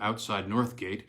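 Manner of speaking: ordinary delivery, nothing marked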